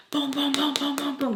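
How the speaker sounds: rapid-fire high booms for door knocks; imitated pounding. Storming upstairs to knock on Sam's door, imitated banging. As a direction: beat out the knocks with your voice. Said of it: "I knock on Sam's door".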